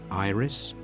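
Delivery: plain reading voice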